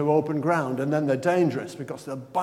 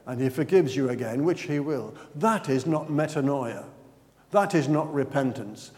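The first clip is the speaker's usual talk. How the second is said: ordinary delivery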